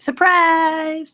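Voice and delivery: loud, high cheerful call; excited surprise. Real, not acted. Streamer greeting a late friend with a loud cheery cry. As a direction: shout it like jumping out at a party.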